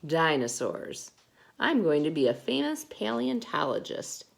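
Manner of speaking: plain reading voice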